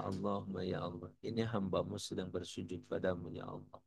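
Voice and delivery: soft, humble supplication; demonstrating an inward prayer, gently; silent-heart prayer. Shown as a demonstration. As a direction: plead quietly with God. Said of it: "Minta ke Allah".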